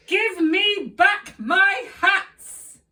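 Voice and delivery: shouted up high and loud, slow; angry shout. Acted. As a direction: bellow it up into the trees. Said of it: "shouted up at them".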